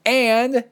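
AND punched loud and long; capitalised AND. Acted. Reader voicing capitals in an email, AND stressed and held. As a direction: lean hard on the word "and". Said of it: "in capital and".

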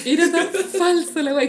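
laughing, high and loud. Real, not acted. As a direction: burst out laughing mid-line. Mocking fake scene. (riendo con burla)

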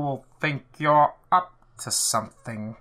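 slow, put-on Harry Potter villain voice; Alan Rickman. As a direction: draw it out into a slow, suspicious warning. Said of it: "you have the sign-off for us".

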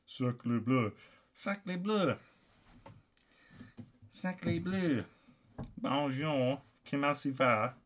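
put-on French accent, fairly soft; hammy French-accented greeting. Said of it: "in the French accent".

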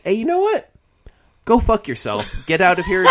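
mock brash dismissal. Stan Lee telling off bigoted readers, mock brash and high-pitched. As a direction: imitate a feisty writer sending readers packing. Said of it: "he's just like".

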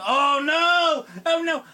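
hammed-up horror; crying out as hopes collapse; mock dismay. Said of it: "and you're like".

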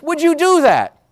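incredulous Jesus asking the crowd; Jesus challenging. Acted. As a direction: throw the question at them in disbelief. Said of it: "And Jesus says".

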